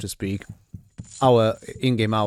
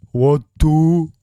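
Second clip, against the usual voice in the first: loud, dim-witted bellow; dopey cartoon bellow; 3 semitones higher, 7 dB louder than usual. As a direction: boom it out like a dumb brute. Player voices dim-witted dialogue choice.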